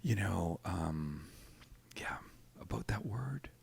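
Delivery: soft awkward whisper. Retold or imitated. Producer gently raising the swear in a whisper. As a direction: hush it like a delicate secret. Producer quietly mentions the word.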